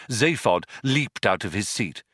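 ordinary delivery

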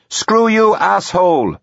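high, loud angry yell; shouted insult. A narrator's reading. Susie cursing the voice from the manor, shouting. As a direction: yell it at the far-off voice. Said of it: "Susie shouted".

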